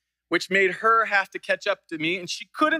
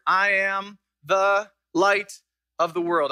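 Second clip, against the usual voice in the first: slow, every word weighed, "light" carried longest; slow the verse down for the congregation; slow, deliberate reading; slower than usual. Shown as a demonstration. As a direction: lay out each of the seven words slowly. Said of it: "I want to slow down".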